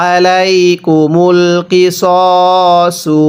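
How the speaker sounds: আলাইকুমুল কিসসু chanted very slowly, vowels held; slow Quranic recitation. Shown as a demonstration. Teacher reciting the full phrase for students, slowly. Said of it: "এক আলিফ টান দিব".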